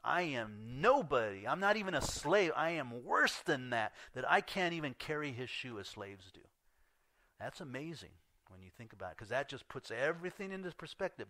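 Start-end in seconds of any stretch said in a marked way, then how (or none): 0.0-6.5 emotional cry of humility. Acted. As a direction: cry it out as a man who is nothing. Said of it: "With all that emotion".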